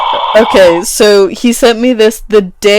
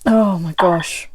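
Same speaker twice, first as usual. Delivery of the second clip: whispering; 2 semitones lower, 5 dB quieter, slower than usual. Real, not acted. Embarrassed aside.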